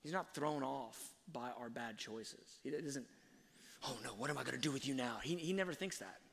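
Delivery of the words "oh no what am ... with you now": rushed, mock-helpless worry; flustered fretting. Imagined father thrown off by bad choices, hurried mock dismay. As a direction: rattle it off as if thrown off.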